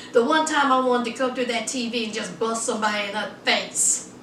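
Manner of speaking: usual speaking voice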